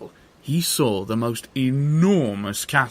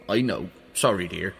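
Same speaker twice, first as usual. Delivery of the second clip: sheepish giant; 6 semitones lower than usual. Acted. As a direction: mumble the apology as a meek giant. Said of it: "said the giant".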